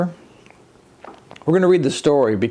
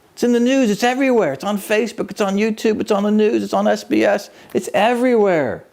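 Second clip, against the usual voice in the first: excited exclaiming; 5 semitones higher than usual. Pretend. Exclaiming the news is everywhere.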